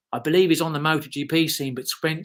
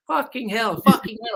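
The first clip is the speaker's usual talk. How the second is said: high, repeated mock Dutch exclamation; hammed-up Dutch accent; 9 semitones higher, 3 dB louder than usual. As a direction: imitate an amazed Dutchman. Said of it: "in this Dutch accent".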